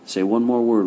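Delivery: loud menacing threat; angry threat. Acted. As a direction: threaten him loudly. Papa threatens Rob.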